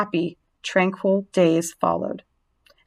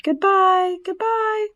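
high, sing-out farewell calls; tiny creatures calling out goodbye, very high; yelled goodbyes; 12 semitones higher, slower than usual. A narrator's reading. Spiderlings yell farewell to Wilbur, high drawn-out call. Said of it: "they yelled".

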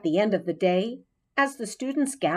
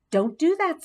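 firm teacher warning; 9 semitones higher than usual. A narrator's reading. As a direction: caution the boy firmly. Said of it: "the teacher warned him".

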